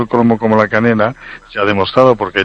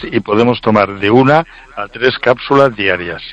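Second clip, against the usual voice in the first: normal talking voice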